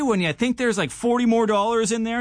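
ordinary delivery

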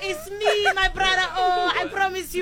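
put-on very high, pleading Nigerian accent; hammed-up Nigerian accent, begging. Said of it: "speak to Nick in a Nigerian accent".

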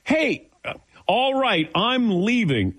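a retold huff of leaving the date; mock exasperated exit. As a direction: sputter out a fed-up goodbye.

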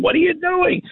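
mock screech in very high pitch; imitated screaming. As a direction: imitate mother screaming from upstairs. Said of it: "You're screaming".

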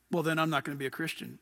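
low, quick, muttered sulky retort; a petulant church-quitter grumbling back at the preacher; mock huffy quitter. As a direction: toss it off like a sulking quitter. Mocking a quitter's huffy reply.

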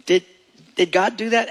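ordinary talk

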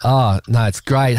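ordinary delivery

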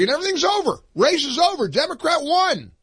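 imitating a hasty winner's announcement; mock victory call. Mock declaring of election victory.

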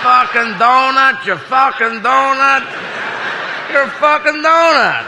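high, slow mock chant; crowd calling out. Berlin crowd shouts to the president, high mock acclaim. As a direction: hail him from the crowd, words stretched.

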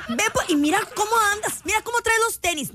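fast, high mock scolding; regaño de mamá. Mother scolding child's appearance. (regaño imitado, rápido y agudo)

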